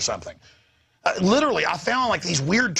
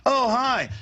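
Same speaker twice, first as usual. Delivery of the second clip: fake friendly; 8 semitones higher than usual. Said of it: "he acted all, like, friendly".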